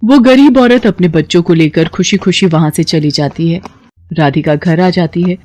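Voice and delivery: ordinary delivery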